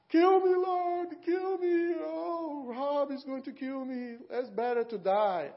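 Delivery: high, loud, wailing plea to die; hammed-up prophet begging for death; despairing Elijah. Elijah begs the Lord to kill him, loud wailing.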